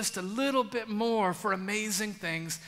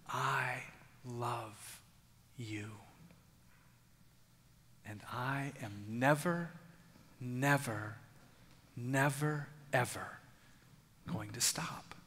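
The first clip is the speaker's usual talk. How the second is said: slow, hushed whisper of God's love; 7 semitones lower, 12 dB quieter, slower than usual. Acted. Preacher voicing God to the congregation, whispered. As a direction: breathe each word softly and slowly.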